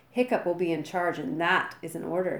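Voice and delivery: plain reading voice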